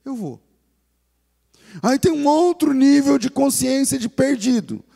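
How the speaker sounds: rising up to rally the congregation; sermon climax, voice lifted high and loud; full-force preaching. Acted.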